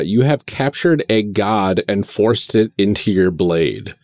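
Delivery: normal talking voice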